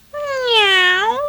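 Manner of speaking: high, drawn-out kitten meow; high-pitched imitated cat cry. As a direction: mew like an eager kitten. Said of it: "said he, trotting towards the duckling".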